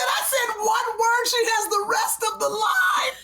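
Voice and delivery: high, slow indignant cry; mock outraged shouting; indignant protest. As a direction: wail it like a wronged dad. Co-host re-enacts dad protesting.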